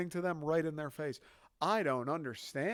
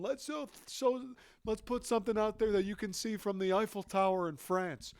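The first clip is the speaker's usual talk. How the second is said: higher, hammed-up smug plotter; mock scheming planner; 6 semitones higher than usual. Chinese planners plotting the balloon, mock smug. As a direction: pitch it like a proud dumb plan.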